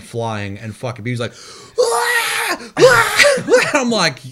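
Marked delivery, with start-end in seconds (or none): 1.8-3.7 very high, loud shrieking wail; imitated baby screaming cry. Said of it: "B B fucking cry".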